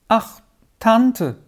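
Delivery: high, pleading little plaint; a touch of a sob, pitched high; mildly tearful. A narrator's reading. Hurt girl appeals to her aunt, mildly tearful. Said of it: "schluchzte jetzt Dora".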